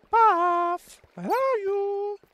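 high-pitched, slow sing-song call. Pretend. Calling out for friend.

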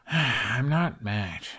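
slow, sighed reply; sighing. A narrator's reading. Hiccup sighing at Kamikaze, slowly denying he is mad. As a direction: let it out on a slow sigh. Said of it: "he sighed".

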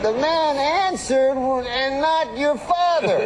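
high, slow, stretched Cosby voice; Bill Cosby impression. Cosby reporting the phone call to the fan, slow and high. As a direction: lay it out slowly like Cosby. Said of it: "He has that funny way of talking".

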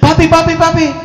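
high, loud pleading call; child's alarmed cry. Acted. Son calling to his father under the knife, alarmed cry. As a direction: cry out urgently as a little boy.

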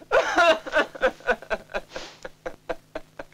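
long broken sob; anguished sob. Acted. Son sobbing before his parents after his confession. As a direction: let the grief break out in a sob.

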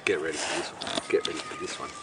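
unvoiced teasing whisper. Real, not acted. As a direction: lean in and whisper the build-up. Teasing a bird noise.

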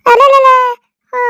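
very loud, high scornful exclamation; explosive sarcastic scoff; mocking outrage. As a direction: jeer at her nerve. Mother-in-law mocks her demand.

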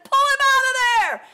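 very high, loud, urgent shout; crying out. Acted. King ordering Daniel out of the den, crying out. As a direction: yell the order, stretching the end. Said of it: "the king cried and he said".

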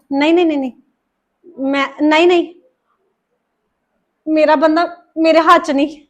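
low, soft and broken; lost, talking to herself in despair. Acted. Daughter-in-law despairing alone.

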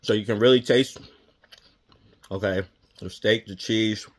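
chewing while talking; muffled lips, food inside; mouth full of food. Real, not acted. Seen 0: Bite at -9; pursed, chewing lips while speaking through 4s.

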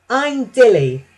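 lightly voiced cheerful barge; friendly barge. A narrator's reading. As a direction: greet them brightly as a barge. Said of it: "said a friendly barge".

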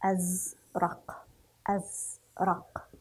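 أزرق slowly, halting on a vowelless z; sukun on zay. Shown as a demonstration. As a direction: split أزرق as "az... raq". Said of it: "فسنقرأه بسكون".

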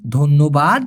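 ordinary talk

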